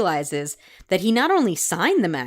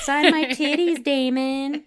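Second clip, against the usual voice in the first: mock fangirl; 7 semitones higher than usual. Mock fan begging actor.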